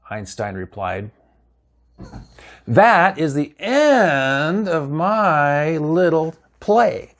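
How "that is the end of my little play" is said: very slow, drawn-out wavering vowels; theatrical sign-off. Acted. Storyteller closing their little play, theatrically drawn out. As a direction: stretch it right out into a grand, higher-pitched finish.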